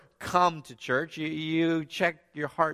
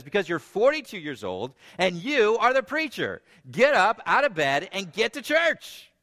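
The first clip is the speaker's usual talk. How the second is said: mother ordering her son out of bed, louder; scolding mother; 5 semitones higher, 4 dB louder than usual. Retold or imitated. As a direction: lay down the law to her son.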